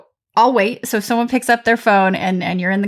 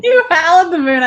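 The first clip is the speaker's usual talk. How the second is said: laughing delight; 8 semitones higher, 4 dB louder than usual. Real, not acted.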